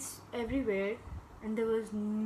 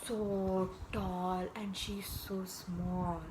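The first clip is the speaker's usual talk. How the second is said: slow, soft and drawn out; hushed awe; 3 semitones lower, 6 dB quieter, much slower than usual. Acted. Storyteller picturing the towering door, hushed awe. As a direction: stretch the words in quiet wonder.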